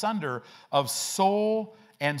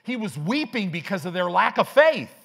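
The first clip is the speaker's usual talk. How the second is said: loud, high-pitched preacher's rebuttal; emphatic correction; 5 semitones higher, 7 dB louder than usual. Real, not acted. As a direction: burst in to overturn the misreading. Preacher corrects the mocked soft reading.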